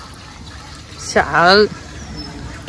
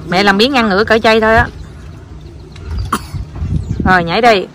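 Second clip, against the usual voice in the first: ordinary talk, nothing marked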